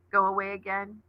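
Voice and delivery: usual speaking voice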